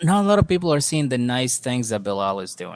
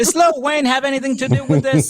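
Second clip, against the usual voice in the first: much higher, louder joke question; mock shout; 10 semitones higher, 4 dB louder than usual. Cohost jokes about the prenup's ghostwriter, loud. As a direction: yell it like an outraged joke.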